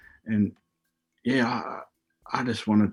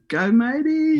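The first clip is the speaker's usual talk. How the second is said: much higher, louder imitated yell from the crowd; mum's yell; 4 dB louder, slower than usual. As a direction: shriek it like mum at the start line. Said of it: "And then I hear".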